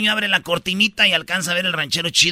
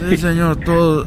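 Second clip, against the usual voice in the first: drowsy man; 5 semitones lower than usual. Acted. Passenger replies in other voice.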